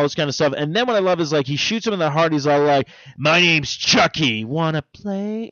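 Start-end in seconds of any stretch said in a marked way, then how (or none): 3.2-5.5 slow, sweet talking-toy voice; lightly voiced toy doll phrase. Ending of the movie, slow talking-toy voice. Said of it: "goes right back to the normal voice of that toy".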